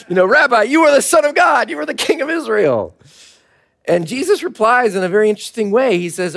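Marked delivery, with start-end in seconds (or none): 0.3-3.0 higher, louder hammed-up declaration of faith; dramatic convert. Nathanael hails Jesus in a mock revival proclamation. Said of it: "pretty dramatic Southern Baptist conversion".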